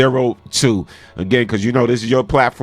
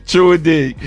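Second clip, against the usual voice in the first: laughing and loud, pitched up; loud laughing agreement; 9 semitones higher, 5 dB louder than usual. Real, not acted. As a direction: shout it out through a laugh. Host laughing, shouting agreement.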